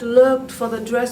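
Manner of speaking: ordinary delivery, nothing marked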